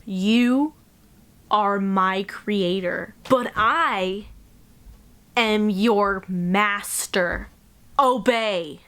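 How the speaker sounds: slow, weighty dramatic reading, word by word; Frankenstein's monster. Acted. Monster orders his creator to obey, slow and commanding. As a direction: deliver it slowly as a commanding creature. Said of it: "Ready for this?".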